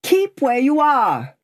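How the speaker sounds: high, drawn-out shouted order; called order. A narrator's reading. Guard calls to the voice in the mist, called order. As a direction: call it out to halt someone unseen. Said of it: "the guard called to the voice".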